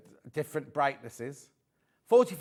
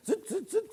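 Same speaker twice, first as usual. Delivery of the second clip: machine noise; 14 semitones higher than usual. Retold or imitated. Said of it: "and then the thing goes".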